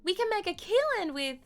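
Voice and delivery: high, loud Southern belle; high-pitched Southern character voice; Southern accent. Acted. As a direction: lay on the Southern twang, up high. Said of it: "she's a little bit Southern".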